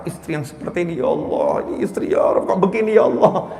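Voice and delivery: low, soft muttered complaint; a husband quietly complaining about his wife; husband's inner grumble. Retold or imitated. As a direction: grumble it under your breath like a tired husband.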